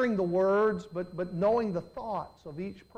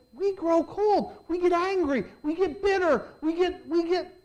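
higher, louder pulpit build; rising preaching; 7 semitones higher, 4 dB louder than usual. Real, not acted. Pastor warning his flock, rising preacher's fervor.

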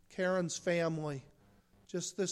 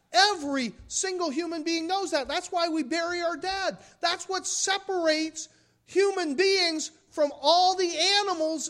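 rising, louder preaching; 9 semitones higher, 6 dB louder than usual. Acted. Pastor pressing the congregation about death, preaching hard. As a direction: build the point higher and higher. Preaching builds to full force.

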